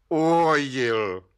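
slow, stubborn one-word answer; dogged. A narrator's reading. Suspect refusing the judge's way out, doggedly. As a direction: stretch the word out, immovably obstinate. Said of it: "pravil Václav Lisický zarytě".

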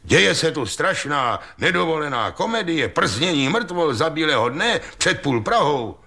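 high, indignant tirade; agitated, high-voiced denunciation; outraged complaint. A narrator's reading. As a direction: protest in outrage, pitched well up. Ostrohradský's outraged complaint to the official.

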